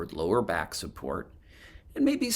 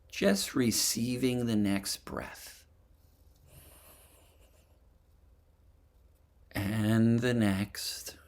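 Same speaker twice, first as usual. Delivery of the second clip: guided meditation; 3 dB quieter than usual. Acted. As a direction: gently lead them through a body scan, phrase by phrase. Leads a breathing and body scan meditation.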